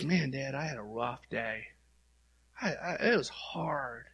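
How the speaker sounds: a touch of discouragement, voice dropped; mildly downcast. Acted. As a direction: confide a rough day to Dad. Acts out a rough-day prayer.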